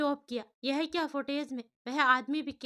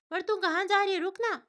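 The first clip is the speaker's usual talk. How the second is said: higher, louder pleading protest; surprised protest; 7 semitones higher, 5 dB louder than usual. Acted. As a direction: stop her from leaving, alarmed. Varsha protests friend leaving.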